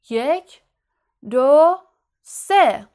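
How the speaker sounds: ringing, spaced-out count; loud countdown. Acted. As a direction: call each number out to start a duel. Lockhart counts down to the duel.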